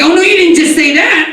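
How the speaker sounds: hammed-up outraged sass; sassy city girl. Comic imitates the city girl scolding him, high loud sass. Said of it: "she's like".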